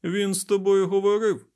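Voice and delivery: high, loud, incredulous question; shouted question. A narrator's reading. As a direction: shout it in disbelief. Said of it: "крикнув Лемюель".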